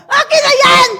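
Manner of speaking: high, loud grabbing shout; retold shout at the ushers. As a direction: yell it as you snatch the cloth. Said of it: "Talagang sumigaw ako".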